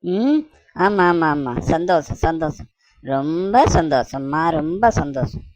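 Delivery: low, drawn-out sarcastic agreement; feigned happiness. Acted. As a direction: drag out a sour show of delight. Dismayed husband feigns being very happy.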